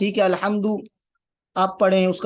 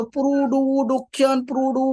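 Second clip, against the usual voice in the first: higher, halting syllable-by-syllable reading; child sounding out words; 5 semitones higher, much slower than usual. Retold or imitated. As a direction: imitate a child stumbling through "production". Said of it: "بچے ایسے کر رہے ہوتے ہیں نا".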